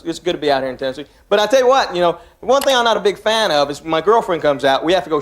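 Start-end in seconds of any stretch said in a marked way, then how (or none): 0.0-1.1 fast throwaway. Real, not acted. Fast mumbled joke slip.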